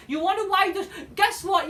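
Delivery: ordinary delivery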